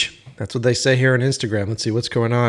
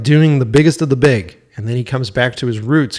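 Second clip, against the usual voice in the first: normal talking voice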